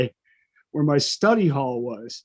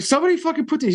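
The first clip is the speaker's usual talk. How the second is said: higher, indignant complaint, imitating his teenage self; upset teen; 11 semitones higher, much faster than usual. Student complaining to the disciplinarian, mock indignant and higher. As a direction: replay the outraged kid slamming it down.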